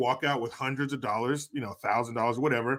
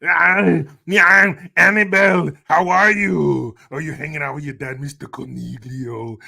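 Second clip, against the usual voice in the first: Arnold voice; 9 dB louder than usual. Pretend. Streamer greets a viewer's daughter Annabelle in Arnold impression. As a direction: greet the little girl as Arnold would. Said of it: "in your Arnold voice".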